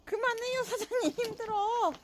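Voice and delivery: high soft whiny plea; squeaky playful pleading. Pretend. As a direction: whine it up high and soft like a pet. Pleading playfully, voicing the dog.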